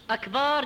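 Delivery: أكبر said as akbaar; long "baar" ending on أكبر; alif after ba. Shown as a demonstration. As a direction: hold the second vowel of أكبر long. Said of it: "إدخال ألف بعد الباء".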